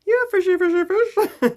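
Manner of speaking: high, loud playful squeal; silly character voice. Acted. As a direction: coo it absurdly high and loud. Improvised silly fish voice.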